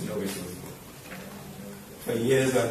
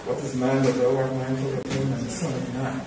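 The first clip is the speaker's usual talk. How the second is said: scripture recitation. Acted. Mourner reciting a psalm for his late teacher, measured. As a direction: recite it like a Sunday school lesson.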